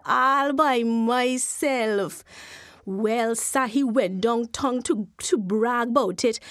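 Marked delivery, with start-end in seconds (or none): 0.0-2.2 very slow, drawn-out smug boast; proud boast. Acted. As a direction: stretch every word, showing off to his wife. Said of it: "He said".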